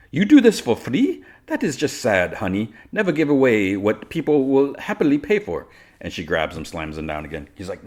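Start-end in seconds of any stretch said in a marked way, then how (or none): 0.1-5.7 high, theatrical villainess, mock pity. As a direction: scoff loudly, then pity him condescendingly.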